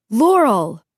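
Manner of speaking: loud desperate cry of her name; desperate call. A narrator's reading. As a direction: call after her, pleading. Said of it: "The desperation in David's voice".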